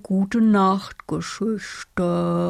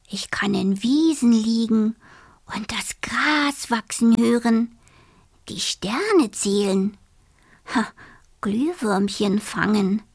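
whisper; 2 semitones higher than usual. Acted. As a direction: whisper it like a secret wish. Dreamy whispered wish.

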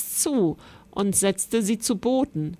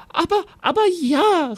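high, loud, fluttering queen's voice; a queen babbling yes in a high voice; flustered queen; 9 semitones higher, 4 dB louder than usual. Acted. Queen hastily agrees to the knight, high and loud. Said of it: "rief die Königin".

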